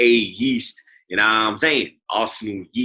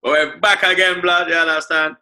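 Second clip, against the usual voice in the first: loud, high-pitched show-opening shout; hype shout; 8 semitones higher, 7 dB louder than usual. Acted. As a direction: pump up the crowd as the episode kicks off. Shouted podcast intro hype.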